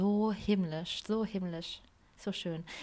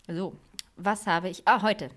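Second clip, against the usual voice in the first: usual speaking voice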